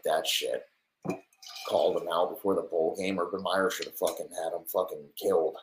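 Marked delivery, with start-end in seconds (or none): none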